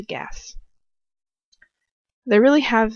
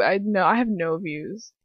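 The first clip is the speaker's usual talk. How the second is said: ordinary delivery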